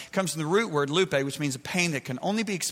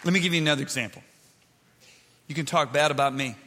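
normal talking voice

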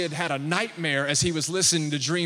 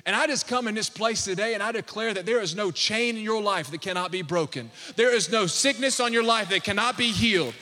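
loud, high preaching declaration; preaching at full force; 4 semitones higher, 5 dB louder than usual. Acted. Pastor declaring healing over the congregation, full force. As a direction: proclaim it over the church with fire.

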